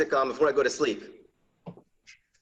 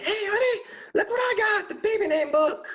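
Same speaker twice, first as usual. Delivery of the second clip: much higher, squealing with glee; mock giddy wife showing off a book; wife bursting in with a new baby name book, squealing; excited wife. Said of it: "brings it home all excited".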